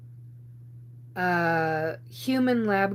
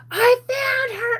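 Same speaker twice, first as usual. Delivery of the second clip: much higher, louder, slow triumphant call; retold yell; 8 dB louder, slower than usual. Young Sasquatch yelling across the silent campground, triumphant shout. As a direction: belt it out like a kid who won hide and seek. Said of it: "And she yelled it".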